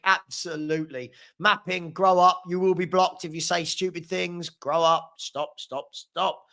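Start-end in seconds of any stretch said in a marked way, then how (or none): none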